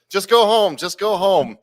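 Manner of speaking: high, loud imitated dismissal; retold shout, high and repeated; loud mock taunt. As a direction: call it out like a taunting bouncer. Bouncer taunts after nose boop.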